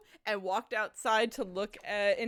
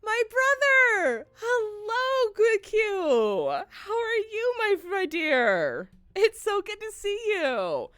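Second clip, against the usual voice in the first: happy tears; 9 semitones higher, 3 dB louder, slower than usual. Real, not acted. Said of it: "I'm also crying a lot".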